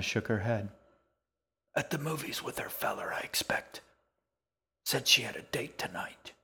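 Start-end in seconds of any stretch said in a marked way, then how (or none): 1.8-6.3 put-on old woman whispering. A narrator's reading. Old woman answers the girl.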